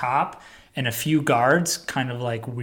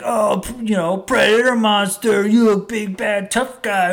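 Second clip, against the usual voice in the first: higher, swaggering mock challenge; cocky taunt; 8 semitones higher, 3 dB louder than usual. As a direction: goad the monster, full of yourself. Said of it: "hyped up on his own ego".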